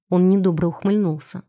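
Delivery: ordinary delivery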